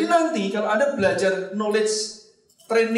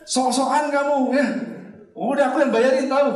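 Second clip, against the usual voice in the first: mock boastful insisting; 8 semitones higher, 3 dB louder than usual. Retold friend insisting on paying.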